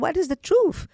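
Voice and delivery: high, loud, alarmed question; retold alarmed demand. Demanding truth on phone.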